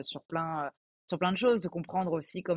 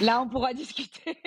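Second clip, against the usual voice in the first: laughing through a higher-pitched retort; 7 semitones higher than usual. Real, not acted. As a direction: joke it out, breaking into laughter. Joking retort about family's French.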